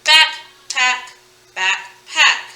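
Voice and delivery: two-beat backpack, said twice; split syllables. Shown as a demonstration. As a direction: BACK and PACK as two separate beats. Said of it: "that's two parts rhyme".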